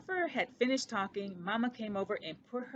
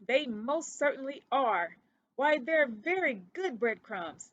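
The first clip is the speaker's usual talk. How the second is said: louder, higher insistence; Ced insisting the crumbs are good, louder; 4 semitones higher, 5 dB louder than usual. Acted. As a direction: stand your ground on it. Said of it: "he insisted".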